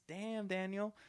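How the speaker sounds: high-pitched mimicked catchphrase; imitating a viral video. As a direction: quote it like the friend filming the meme. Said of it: "he just, like, Snapchats".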